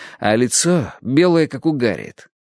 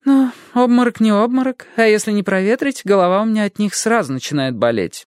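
fussy lady voice; 11 semitones higher than usual. A narrator's reading. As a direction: fuss over the fainting girl. Someone voiced by narrator. (суетливая дама)

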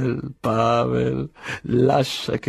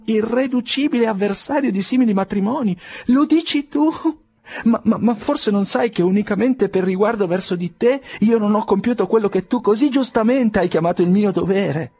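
much higher, louder, tumbling amazement; astonished outburst; 12 semitones higher, 3 dB louder than usual. Acted. Nikolai protesting to Pavel in amazed excitement, high and loud. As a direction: cry out in disbelief, voice leaping up.

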